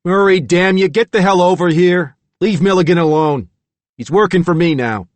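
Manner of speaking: loud, high-pitched angry yell; calling out. Acted. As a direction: yell an order at your henchman from a distance. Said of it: "Foley called from his car".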